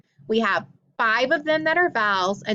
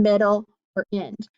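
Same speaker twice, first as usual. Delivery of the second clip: ordinary talk, nothing marked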